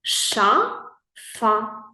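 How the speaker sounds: "Ша-фа" split into two clear syllables ША and ФА. Shown as a demonstration. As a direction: clap out "Ша-фа" slowly, one syllable at a time. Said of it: "Давай це перевіримо".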